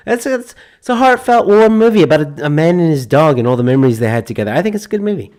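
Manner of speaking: high, loud gushing movie-trailer voice; hammed-up sentimental gushing; mock heartfelt pitch. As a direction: oversell it like a sappy ad.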